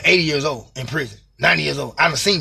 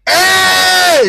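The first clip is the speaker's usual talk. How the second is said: high, loud call through a vent; yelling down the vent to the other rooms; 14 semitones higher, 9 dB louder than usual. Retold or imitated. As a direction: holler to be heard in the next room.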